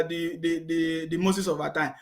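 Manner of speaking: ordinary talk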